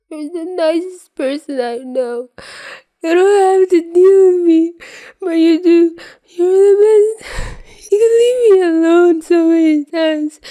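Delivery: high, loud tearful sobbing; crying out loud. Acted. Drugged Peter crying gratitude at Tony, high loud wail. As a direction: wail the thanks through tears. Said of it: "eyes start welling up with tears".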